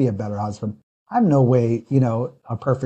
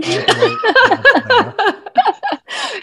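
much higher, slow, dissolving into laughter; laughing; 4 dB louder, much slower than usual. Real, not acted. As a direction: laugh the words out slowly. Joking about wife's rule.

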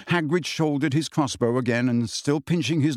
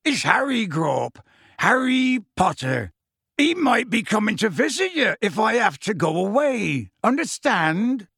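Hagrid addressing the giant; 7 semitones higher than usual. Acted.

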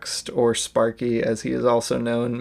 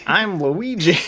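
a touch higher put-on Luigi voice; lightly voiced Luigi line; 5 semitones higher, slower than usual. Shown as a demonstration. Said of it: "can you pull off the "I'm Luigi".

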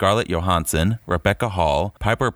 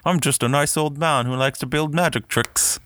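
put-on sweet old man voice; 7 semitones higher than usual. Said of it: "he was kinda just like".

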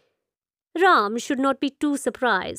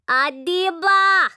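slow, loud high call; 7 semitones higher, 4 dB louder, slower than usual. Acted. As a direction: call the name across the house. Madam calling out for Adiba.

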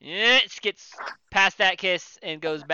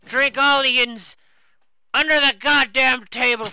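put-on loud drunk boast; 5 semitones higher, 7 dB louder than usual. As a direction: brag loudly at the table. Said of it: "this guy's like".

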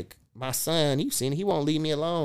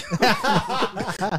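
laughing; 9 semitones higher, 5 dB louder than usual. Real, not acted. Dad cracking up while telling the pee bottle story, high laugh.

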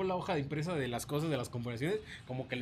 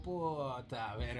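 slow muttered curse; 3 semitones lower, 5 dB quieter, much slower than usual. Retold or imitated. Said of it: "voltean así como".

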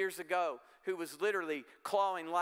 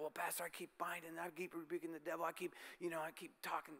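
much softer, half-mumbled grumble of a churchgoer; mock complaining congregant; 12 dB quieter than usual. Pastor imitating a frustrated churchgoer, softly and sheepishly. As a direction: shrink it into a faint, sheepish excuse.

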